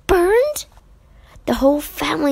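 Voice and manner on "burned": high, loud incredulous burned; can't believe what she hears; shocked. A narrator's reading. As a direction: Jo shocked, shrill disbelief. Jo's shocked disbelief.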